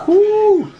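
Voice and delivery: high, loud whoop; excited whoop. Real, not acted. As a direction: cheer it out. Excited before first bite.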